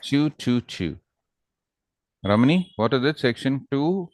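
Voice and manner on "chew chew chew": "chew" said as a rounded ch-oo; chew accent demo. Shown as a demonstration. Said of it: "say chew".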